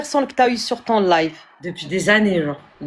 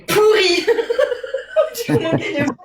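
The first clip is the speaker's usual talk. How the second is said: laughing through the repeat, much higher; giggling as she repeats the insult; amused, high laughing repeat; 12 semitones higher than usual. Real, not acted. Repeating sister's mockery, laughing.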